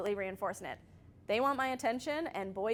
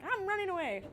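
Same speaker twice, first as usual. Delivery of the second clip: high-pitched imitation of a defiant kid; mock child defiance; 10 semitones higher than usual. Son threatens mom with leaving, mock defiance. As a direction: announce it like a kid in a huff, pitched up. Retells child running away.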